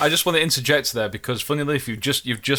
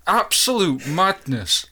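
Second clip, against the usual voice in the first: ordinary delivery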